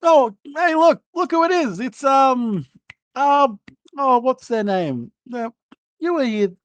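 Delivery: high, jittery guard caught off guard; flustered guard. Acted. Guard greeting a visitor at the gate, high and flustered.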